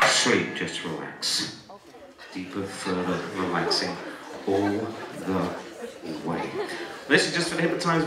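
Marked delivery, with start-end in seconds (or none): 0.1-6.5 slow, low, soft hypnotic lull; hypnotist's soothing voice. Real, not acted. Hypnotist relaxing volunteers deeper, soothing. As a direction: ease them down, slowly and quietly.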